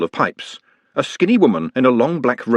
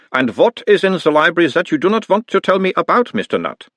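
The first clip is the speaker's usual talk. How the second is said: put-on higher, louder doctor's voice with a light Uberwald accent; mild Uberwald accent; 9 semitones higher, 4 dB louder than usual. A narrator's reading. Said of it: "talking like someone from Uberwald".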